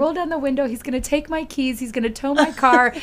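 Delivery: high, loud panicked rush of worries; terrified. Retold or imitated. College girl's racing thoughts at the police officer's window, retold panic. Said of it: "I was terrified that".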